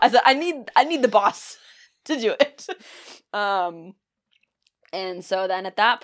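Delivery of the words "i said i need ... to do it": loud, higher apologetic babble; flustered loud pleading. Retold or imitated. Apologizing to the young pharmacy worker, loud and flustered. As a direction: blurt it out loud, embarrassed. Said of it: "I was like".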